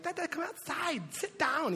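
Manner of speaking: put-on high-pitched little-kid voice; imitating his toddler. Two-year-old son inviting dad outside, high imitated child voice. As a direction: play a small child calling to Dad, high. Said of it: "and he's like".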